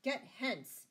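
high, loud angry order; furious shout. A narrator's reading. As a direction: shout him out of the place. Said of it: "shouted the abbot".

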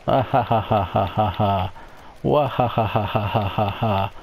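evil villain laugh. Acted. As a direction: cackle menacingly like a phantom.

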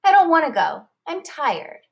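a tired small firefly refusing; little Lucy, sulky. A narrator's reading.

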